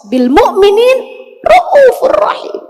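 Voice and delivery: rising to a crying, high preacher's wail; impassioned cry. Real, not acted. Preacher crying out the verse to the congregation, high and loud.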